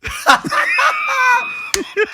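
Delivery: a burst of high, loud laughter; shrieking laugh. Real, not acted. Laughing at the co-host's pronunciation, a loud shrieking laugh. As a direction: crack up at the silly pronunciation. Friend's silly Scottish-sounding pronunciation.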